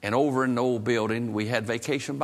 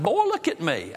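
higher, raised, insistent voice; frustrated demand; 6 semitones higher than usual. Retold or imitated. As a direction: demand his attention forcefully. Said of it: "I was about half mad at him".

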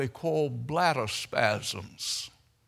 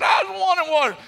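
high, loud lady's voice; retold exclaiming lady; 12 semitones higher, 7 dB louder than usual. Lady asking after her preacher, loud high voice. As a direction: call it out loudly from the doorway. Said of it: "She said".

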